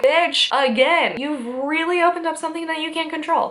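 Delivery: scolding Mulder and Scully. Retold or imitated. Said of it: "they're like".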